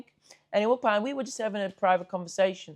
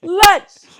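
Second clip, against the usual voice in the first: much higher, much louder yelled call; calling out from afar; 12 semitones higher, 15 dB louder than usual. Retold or imitated. Stranger yells after the lads from far off, loud and high. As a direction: holler across two hundred meters.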